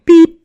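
high, loud machine beep; imitated beep. Marking the next phone message, a piercing imitated beep.

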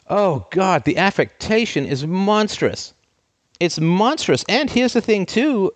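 high, drawn-out exasperation; exasperated. Real, not acted. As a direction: lament it in high-pitched disbelief. Exasperated rant at the acting.

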